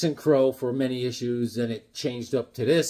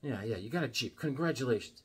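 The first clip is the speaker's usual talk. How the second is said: sarcastic; 3 semitones lower, 12 dB quieter than usual. Retold or imitated. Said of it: "I'm like".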